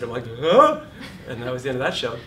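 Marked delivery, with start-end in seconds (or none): 0.4-0.8 high, loud startled huh; a sudden high loud huh; shocked huh. Retold or imitated. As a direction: blurt out total surprise. Hearing shocking news in shower.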